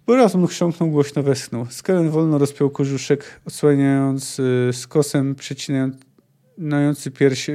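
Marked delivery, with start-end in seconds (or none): none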